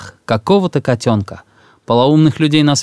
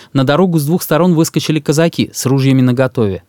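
ordinary delivery

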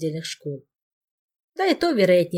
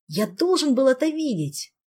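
excited; 8 semitones higher, 4 dB louder than usual. Acted. Ghost begs to have seen it.

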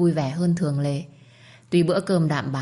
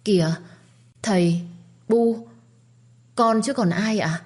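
shy, embarrassed; 5 semitones higher than usual. A narrator's reading. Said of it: "Báo ngượng ngùng lên tiếng".

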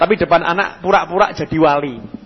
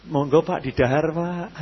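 soft, gentle, humble offering; refined wife; 8 dB quieter than usual. Retold or imitated. Wife politely offers her husband food, soft and humble. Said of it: "Istrinya itu halus gitu ya".

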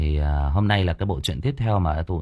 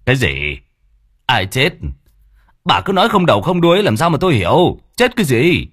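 louder, higher snapping questions; irritated old man; 7 semitones higher, 8 dB louder than usual. Acted. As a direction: demand answers, losing patience. Long irritated, demanding. (ông Long bực bội quát hỏi)

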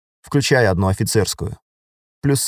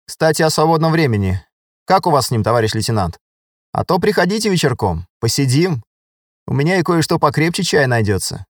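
lightly voiced inviting sergeant, pitched up; flirty woman sergeant; 6 semitones higher than usual. Masha invites the lieutenant to her dugout, lightly flirty. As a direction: invite him over, lifting the voice.

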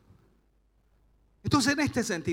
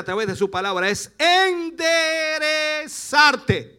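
loud, emphatic preaching; prédica enérgica; 7 dB louder than usual. Real, not acted. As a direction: drive each phrase home to the congregation, loudly. Predica con fuerza. (predicando con fuerza)

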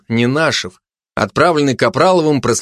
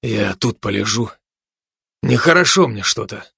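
weak, subdued voice; understated ailing mumble; feeling unwell. A narrator's reading. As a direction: speak as if strength is draining. Wounded commando lying down, feeling unwell.

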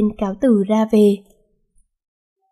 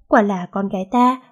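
ordinary talk, nothing marked

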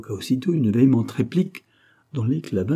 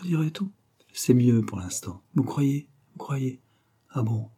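ordinary delivery

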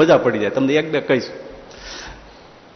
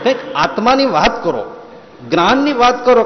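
lofty mock demand; 7 semitones higher than usual. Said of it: "એમાં શું સાંભળવાનું?".

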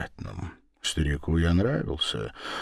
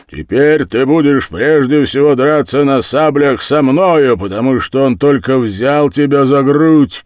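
loud, high-pitched threat; rasped angry challenge; hoarse menace; 8 semitones higher, 11 dB louder than usual. A narrator's reading. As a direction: threaten them in a dreadful hoarse voice. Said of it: "просипел он таким голосом".

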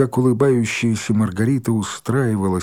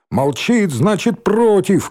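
raised cry climbing high; mild shout, pitched up; shouted accusation; 8 semitones higher, 4 dB louder, slower than usual. A narrator's reading. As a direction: call it out to the crowd. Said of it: "крикнул Рыжий".

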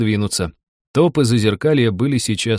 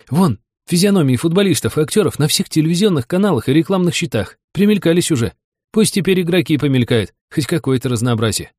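higher-pitched, lively character voice; animated fellow passenger; 8 semitones higher, 3 dB louder than usual. Acted. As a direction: butt in eagerly with an opinion. Narrator voices Андрей joining in. (оживлённый попутчик, голос повыше)

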